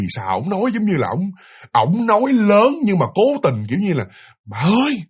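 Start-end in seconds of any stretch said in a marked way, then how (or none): 4.4-5.0 father calling his wife in a pitched-up voice; raised-pitch call. Retold or imitated. Father calling his wife in a high put-on tone. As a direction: call out high so the daughter won't catch it. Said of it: "nói cái tông, tông giọng nó cao lên á".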